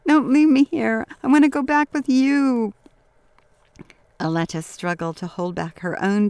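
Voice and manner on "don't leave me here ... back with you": high, loud tearful pleading; sobbing child. A narrator's reading. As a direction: beg desperately through tears. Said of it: "sobbed Mario".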